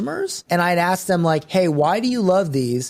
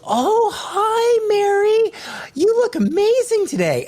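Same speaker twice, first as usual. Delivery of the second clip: much higher, squeaky sweet woman's voice; high, sing-song mock compliment; sugary fake greeting; slower than usual. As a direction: gush over her like a fake-nice friend. Acting a sugary bar greeting.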